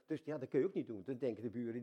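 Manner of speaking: ordinary talk, nothing marked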